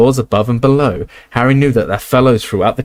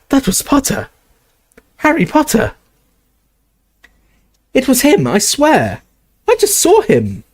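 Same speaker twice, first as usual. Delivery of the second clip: very high, breathless excited cries; excited students; 14 semitones higher than usual. Acted. Students spot Harry Potter, very high and excited. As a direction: gasp it out, thrilled.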